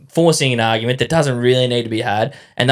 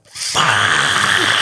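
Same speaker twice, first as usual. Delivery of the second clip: exasperated yell; 4 semitones lower than usual. Retold or imitated. Reliving exasperated scream in car.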